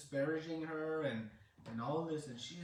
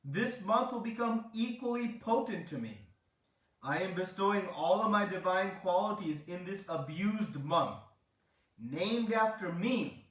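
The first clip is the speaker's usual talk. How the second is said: loud, high-pitched retold decree; divine proclamation; 4 semitones higher, 6 dB louder than usual. Krishna bestows his qualities on the abused month, loud proclamation. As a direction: declare it grandly, as a god bestowing a gift.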